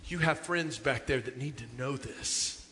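hushed pleading. Retold or imitated. Youth minister urging the kids, soft and earnest. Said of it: "the youth minister would stop and say".

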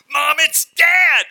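very high, loud and slow cry of alarm; a child screaming that Dad is at the door; alarmed child shout. Acted.

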